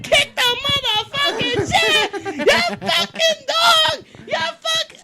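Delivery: high, slow furious yell; angry husband. Retold or imitated. Husband yelling at the dog, high furious shout. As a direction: chase the dog off.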